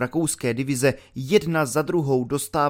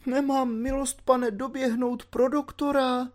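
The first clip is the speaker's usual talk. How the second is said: put-on much higher, tearful old woman's voice; tearful landlady; 11 semitones higher than usual. A narrator's reading. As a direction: plead through tears as the landlady. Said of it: "se dala do pláče". (plačtivá stará paní)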